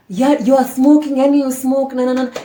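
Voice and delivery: mock the mother telling her son off; imitating angry mom. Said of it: "his mom is at the door".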